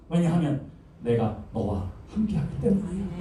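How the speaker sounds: drops to a soft, intimate tone; soft reassurance. Acted. God reassuring Jeremiah after commanding, soft and close. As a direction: settle into a gentle promise.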